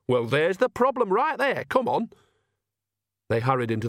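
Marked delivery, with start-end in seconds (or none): 0.1-2.1 high-pitched, emphatic character voice; lively, bossy encouragement; brisk urging. Acted. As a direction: jump on the answer and chivvy her along. Nanny briskly solves it, urges Agnes.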